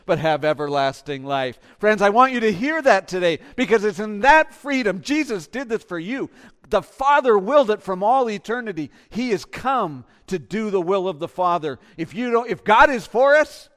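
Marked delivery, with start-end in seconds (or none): 1.8-13.7 loud, high, ringing exhortation; preaching at full force. Acted. Preacher to congregation about God's deliverance, rousing full-force preaching. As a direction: lift the whole room with it.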